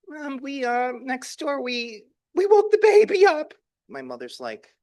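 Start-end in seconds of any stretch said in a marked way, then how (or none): 0.1-3.5 high, loud panicky confession; a frightened kid blurting it out; panicked child. Retold or imitated. The boy confesses to his mother, high and panicked.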